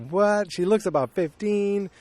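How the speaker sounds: high-pitched retold disbelief; incredulous reaction. Viewer reacting to the girl being of age, incredulous. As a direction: replay your disbelief, voice jumping high. Own shocked reaction to the film.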